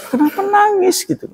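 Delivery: high puzzled voice asking the crying father; puzzled guest. Retold or imitated. Wedding guest asks the father why he cries, high and puzzled. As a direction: squeak out a baffled question. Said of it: "Bapaknya itu".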